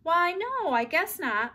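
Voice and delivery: mother calling back up the stairs; called reply. A narrator's reading. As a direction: answer from the basement. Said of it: "Mother replied".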